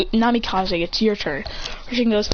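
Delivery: ordinary delivery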